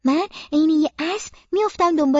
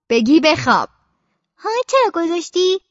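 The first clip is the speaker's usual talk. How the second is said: mother's stern voice; 4 dB louder than usual. Acted. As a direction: scold the child like a tired mother. Mother voiced scolding in story.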